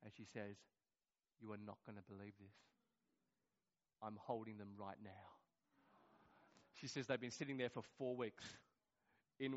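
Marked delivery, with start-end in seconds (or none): 1.4-5.1 very soft, low, suspenseful delivery; hushed reveal. Retold or imitated. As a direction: imitate breaking astonishing news quietly. Suspenseful reveal in retold call.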